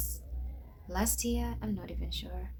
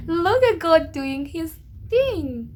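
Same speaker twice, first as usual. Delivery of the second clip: slow, louder, higher exultation; joyful praise; 8 semitones higher, 7 dB louder, slower than usual. Real, not acted. Praising God excitedly.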